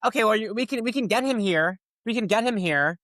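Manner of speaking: high, lightly mocking district attorney voice; imitating the prosecutor. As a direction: play her as patronising. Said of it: "And then she goes".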